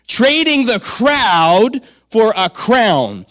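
Trading the crowd for a crown drawn out word by word; slow hammered phrase. Shown as a demonstration. Preacher repeating his title to the church, slow and weighty. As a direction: lean on each word slowly. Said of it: "Come on".

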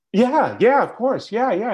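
high and loud eager waiter; high excited yeah yeah, of course. Retold or imitated. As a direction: brush her off with quick eager agreement. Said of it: "And he goes".

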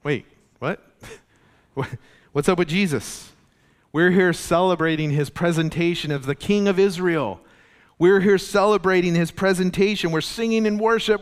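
hammed-up puzzled crowd protesting; confused crowd. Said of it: "confused the people".

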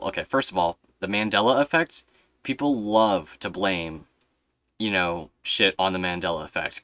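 normal talking voice

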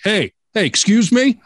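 high, overly polite call-out; polite mock caller. YouTuber politely hailing the next car, pitched high. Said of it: "and be like".

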